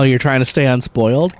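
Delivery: ordinary talk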